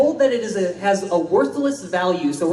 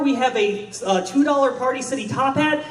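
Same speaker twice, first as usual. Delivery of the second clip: normal talking voice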